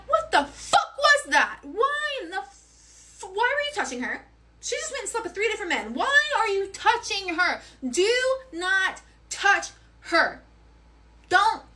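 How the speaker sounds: re-enacted furious scolding, pitched high; pissed off. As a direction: demand it in outrage, stretching the key words. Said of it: "I'm pissed".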